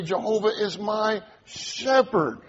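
high lead-in, then "shepherd" set apart and punched; stress on shepherd. Shown as a demonstration. Preacher emphasizing different words of the verse, stress on "shepherd". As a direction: hold back briefly, then land hard on "shepherd". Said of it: "We can even emphasize different words".

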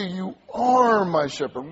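"are" stretched and pushed forward; stress on are. Shown as a demonstration. Preacher praying the verse back to the Lord, stress on "are". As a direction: lean long on "are", then let the rest fall. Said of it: "We can even emphasize different words".

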